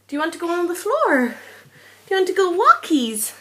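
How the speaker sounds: high, sing-song voice to a pet; pet talk. Real, not acted. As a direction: coax the dog in a high voice. Talking to her pet.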